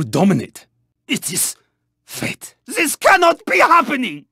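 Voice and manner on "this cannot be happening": high-pitched, dismayed outburst; outraged disbelief. Acted. Racer facing a sudden setback, high outraged disbelief.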